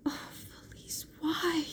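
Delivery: hushed, then rising on why; soft pleading whisper; whispered plea. A narrator's reading. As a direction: breathe it, voice lifting on the question.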